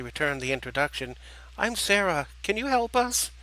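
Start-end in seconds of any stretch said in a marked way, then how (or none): 1.6-3.3 put-on high child's voice, rising plea; little girl. Little girl asking strangers for help, high and hopeful.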